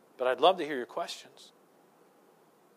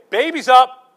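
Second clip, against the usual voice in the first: loud, high holler to a sleeping spouse; hammed-up shout across the bed; mock wake-up yell; 9 semitones higher, 14 dB louder, slower than usual. Mock-compassionate wake-up yell to wife.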